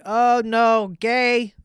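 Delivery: high, loud imitation of hecklers; mock jeering crowd. Host imitating people reacting to his liking [name], mock jeer. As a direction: call it out high, in mock dismay.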